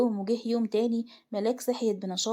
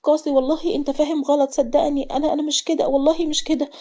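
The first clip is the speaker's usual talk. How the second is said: higher, louder stammering plea; tense, tearful; 4 semitones higher, 7 dB louder than usual. A narrator's reading. Wife swears innocence to angry husband, tense and tearful. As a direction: plead anxiously, tripping over the first words. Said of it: "ملاك بتوتر ودموع".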